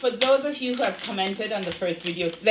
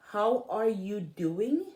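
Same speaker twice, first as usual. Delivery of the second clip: slow emphasis; slower than usual. Shown as a demonstration. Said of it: "slowed key phrase".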